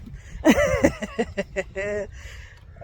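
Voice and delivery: long run of laughter; winded laugh. Real, not acted. Hiker already out of breath, winded laugh. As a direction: laugh at your own puffing.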